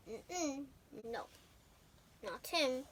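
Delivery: soft hushed no; quiet refusal. Acted. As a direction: gently whisper the refusal. God rejecting the son.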